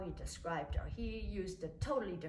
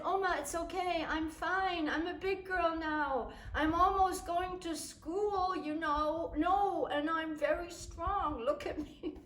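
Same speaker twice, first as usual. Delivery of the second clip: put-on higher, childlike, insisting she's a big girl; proud little girl; 7 semitones higher than usual. Small girl assuring her grandmother she is strong, high and proud. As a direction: boast like a small child, laughing at the end. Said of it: "And I said".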